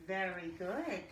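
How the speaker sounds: a long breathy h before "Very good"; breathy h sound. Shown as a demonstration. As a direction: breathe out an h, then praise. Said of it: "h sound demo for kids".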